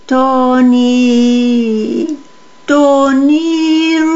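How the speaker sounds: high, drawn-out, almost sung call; ghostly calling. A narrator's reading. Lost brothers' voices call the boy, ghostly calling. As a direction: let the name float out long and high. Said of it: "llamaban las voces".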